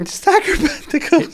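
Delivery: very high, drawn-out echo; playful echo. Pretend. Echoing a friend's favourite name, high playful voice. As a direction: squeal the name slowly in play.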